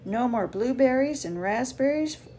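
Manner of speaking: gentle, lively picture-book read-aloud; reading to children. Acted. Author reading her bee book to kids, gentle and lively.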